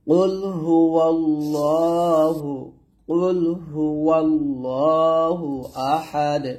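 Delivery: slow melodic tilawat of কুল হুয়াল্লাহু; teacher reciting for students to repeat; Quran recitation. Shown as a demonstration. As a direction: recite it slowly with a chanting tune. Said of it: "আবার বলি".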